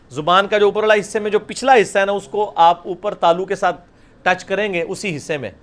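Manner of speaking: ordinary talk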